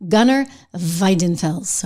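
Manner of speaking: Gunnar Weidenfels slow, with a German V and rolled vowels; German pronunciation. Shown as a demonstration. Host naming the CEO for dramatic effect, German pronunciation. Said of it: "I'm just gonna go with the German".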